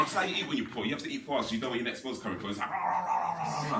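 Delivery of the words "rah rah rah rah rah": mock gobbling growl; ravenous eating noise. Poor kid wolfing dinner at friend's house, ravenous gobbling noise. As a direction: imitate wolfing down food noisily.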